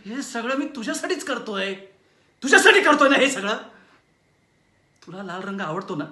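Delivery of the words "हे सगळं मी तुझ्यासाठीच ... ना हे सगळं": rising from firm to a very loud, high shout; building to a rage. Acted. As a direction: explode on the second line. (पत्नीवर ओरडत संताप उफाळून येतो)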